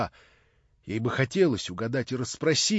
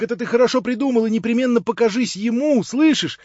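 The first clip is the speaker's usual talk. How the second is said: put-on young man's voice, higher and louder, gushing; eager young Alyosha; 10 semitones higher, 10 dB louder than usual. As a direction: Alyosha bubbling over with delight. Alyosha voiced by the reader, excited. (восторженный юноша, выше и громче)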